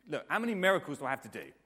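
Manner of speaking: loud, fast exasperated outburst. Acted. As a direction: say it as if patience has run out. Acted exasperated Jesus quote.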